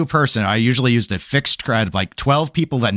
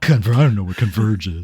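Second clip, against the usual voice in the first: mocking dumb voice. Repeating the mocked quote.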